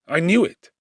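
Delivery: ordinary delivery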